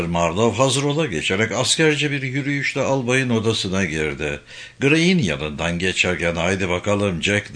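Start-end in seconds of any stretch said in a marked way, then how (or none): none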